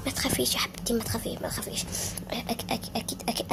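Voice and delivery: low soft whisper to calm her; trapped girl whispering comfort to sister. Acted. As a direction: hush her gently. Whispering reassurance under rubble.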